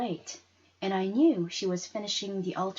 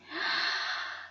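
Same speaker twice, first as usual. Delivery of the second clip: breathless gasp; 4 dB quieter than usual. Acted. Girl sees money in envelope.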